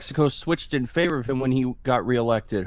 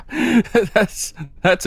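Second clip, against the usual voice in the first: laughing; 9 semitones higher, 3 dB louder than usual. Real, not acted. Laughing at a lopsided result.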